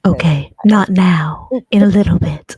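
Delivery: hushed whisper. Real, not acted. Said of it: "if I whisper in it".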